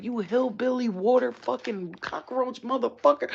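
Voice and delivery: loud, high, drawn-out string of insults; retold cursing out. Cursing out the man who insulted her, loud hammed-up anger. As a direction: hurl each insult slowly and loudly. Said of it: "cursing him out".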